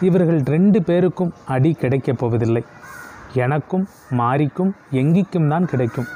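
ordinary delivery

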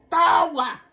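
high, loud cartoon outburst; Homer Simpson. Gamer showing how else he would sound, loud cartoon yelp. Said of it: "Now you sound like a Homer Simpson".

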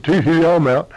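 loud, hammed-up tee-hee; gleeful daddy. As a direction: crow it as the freed daddy. Daddy escapes the bear's belly.

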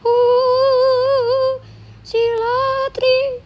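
high, long-held wail; crowd's chant. Acted. Storyteller repeating the villagers' cries, high drawn-out wail. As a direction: hold each syllable high and long.

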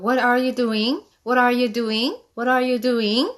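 put-on slow, rising question to a child; What are you doing with a rise on are; asking a child. Shown as a demonstration. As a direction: ask it gently, tune rising at the end. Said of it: "자주자주 물어보세요".

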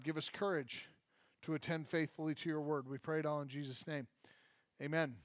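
hushed congregational prayer. Real, not acted. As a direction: pray quietly to open the teaching. Pastor prays before teaching.

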